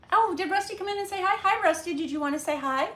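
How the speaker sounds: high, fast, sing-song greeting to the puppy. Real, not acted. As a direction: coo hello to your dog, way up high. Greeting the dog.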